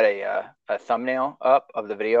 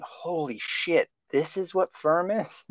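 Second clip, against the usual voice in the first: amazed; 6 semitones higher, 4 dB quieter, slower than usual. Retold or imitated. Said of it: "just thinking".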